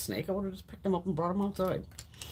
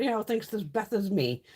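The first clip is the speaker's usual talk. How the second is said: usual speaking voice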